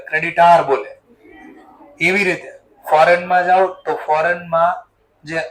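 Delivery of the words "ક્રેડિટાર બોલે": ક્રેડિટાર with a long taar ending; southern pronunciation. Retold or imitated. Teacher showing students the southern ક્રેડિટાર, long aa. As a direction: say ક્રેડિટાર stretching the last vowel. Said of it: "ક્રેડિટર નો બોલે".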